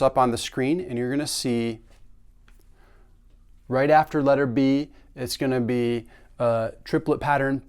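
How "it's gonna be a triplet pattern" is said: usual speaking voice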